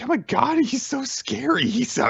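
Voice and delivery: a high, mock panic in a playful voice; venting. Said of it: "he kind of just vents a bit".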